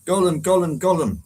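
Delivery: playful Gollum gulping; Gollum impression. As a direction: gulp the name out like Gollum. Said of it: "Yes, that's me".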